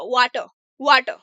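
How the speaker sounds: वाटर said as "waa-tuh", the final r silent; r dropped. Shown as a demonstration. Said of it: "practice करना होगा".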